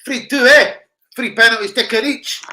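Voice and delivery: loud high score call; excited shout. Real, not acted. Reacting to score.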